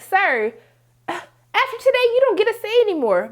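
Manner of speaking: high-pitched, heated mock reprimand; father's outburst. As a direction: lay down the law, imitating an angry father. Acts father laying down the law.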